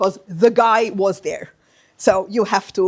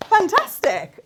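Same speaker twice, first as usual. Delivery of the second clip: excited praise; 10 semitones higher, 4 dB louder, slower than usual. Real, not acted. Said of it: "praising the learner".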